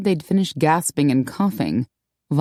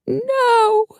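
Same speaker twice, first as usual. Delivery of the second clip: put-on higher, brighter girlish voice; high young woman voice; 3 dB louder than usual. A narrator's reading. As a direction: play the upset young woman. Maggie's dismay.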